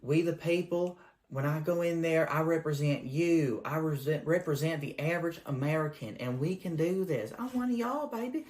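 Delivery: lightly hammed-up Southern accent; southern tang. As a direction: candidate pandering to Georgia in a Southern accent. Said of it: "this southern tang in your voice".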